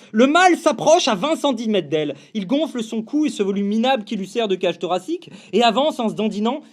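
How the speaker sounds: usual speaking voice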